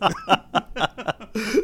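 real high-pitched giggling fit; high laugh. Real, not acted. Joker after confessing to his friend, high laughing fit. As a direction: dissolve into laughter at your own joke.

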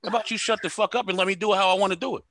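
normal talking voice

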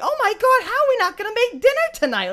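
high, loud whiny sitcom mimicry; mocking sitcom voice. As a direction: squeal it like a corny sitcom line.

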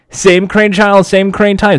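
hammed-up sixties TV narrator sign-off; old Batman TV announcer. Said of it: "that's a reference to Batman".

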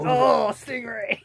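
high, slow, drawn-out mock Aussie cry; Steve Irwin. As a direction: stretch it out like a croc hunter. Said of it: "Steve Irwin".

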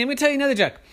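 high, urgent offer; urgent bargaining. Acted. Kenny offers shark another joke, urgent high plea. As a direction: blurt it high to stall.

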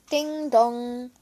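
sing-song two-note ding dong; drawn-out doorbell imitation; doorbell chime.

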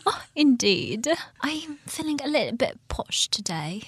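put-on a playful posh British accent; mock British accent. To her co-host, says she feels posh, in a British accent. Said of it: "continue with this British accent".

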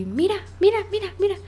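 put-on high, eager child voice; excited toddler begging; little kid voice. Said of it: "un niñito que quiere jugar".

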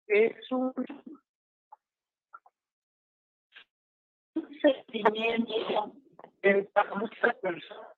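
broken, choppy phone audio; call dropping out. Real, not acted. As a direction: voice cutting out on a bad line. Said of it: "te estás cortando un poco".

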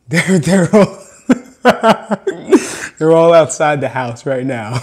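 words broken up by laughter; real laughing. Real, not acted. Explaining who is outside the house, laughing for real. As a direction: try to explain through your own giggles.

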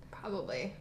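hushed whispered reply. Real, not acted. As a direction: answer in a secretive whisper.

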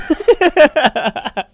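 a burst of loud, high laughter. Real, not acted. Laughing at own joke about views, loud high laugh.

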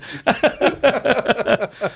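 high breathy giggle; helpless giggling; giggling fit. Real, not acted. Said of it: "I can't keep a straight face".

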